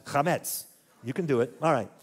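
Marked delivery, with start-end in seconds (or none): 0.0-0.6 chametz again, throaty kh and stress on METS; teacher prompts Sebastian to say chametz; Hebrew pronunciation. Shown as a demonstration. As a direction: coax a student with a clear model of chametz. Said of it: "Come on, Sebastian".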